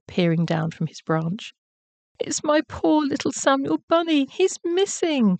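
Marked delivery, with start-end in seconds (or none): 2.2-5.4 high-pitched anxious mother rabbit; distressed cry. A narrator's reading. Missus Rabbit tells Horatio of her missing son, crying out. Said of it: "cried Missus Rabbit".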